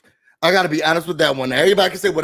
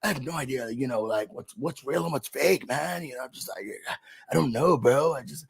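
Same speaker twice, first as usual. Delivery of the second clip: much softer, mopey mumbling impression; quietly imitating a lost guy; mumbling mock houseguest; 10 dB quieter than usual. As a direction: mumble like a confused bro. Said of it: "he's like".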